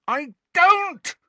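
high, loud screaming denial; boy screaming at his headmaster. A narrator's reading. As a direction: scream until the throat might tear. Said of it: "Harry screamed".

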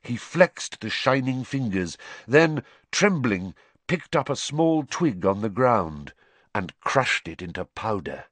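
ordinary delivery, nothing marked

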